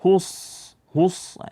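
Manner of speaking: "Hus" with a thick, heavy s like Arabic sad; wrong sad. Shown as a demonstration. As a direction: darken the s in "Hus" into an emphatic sad. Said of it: "jangan dibaca dengan sad".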